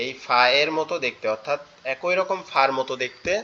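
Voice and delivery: ordinary delivery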